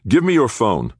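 high, loud forceful order; demanding. A narrator's reading. Jordan orders the injured man to hand over his phone, demanding. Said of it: "Jordan demanded".

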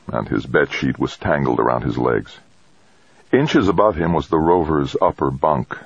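plain reading voice

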